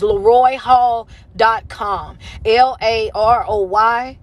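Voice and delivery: slow, spaced-out web address; slow spelling-out. Real, not acted. Host gives viewers the coaching website, slowly spelled out.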